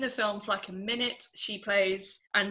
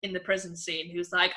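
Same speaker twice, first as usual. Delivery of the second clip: ordinary talk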